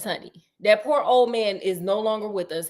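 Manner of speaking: normal talking voice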